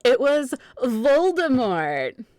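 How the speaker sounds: hammed-up dramatic announcement; dramatic reveal. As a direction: unveil the villain with big drama.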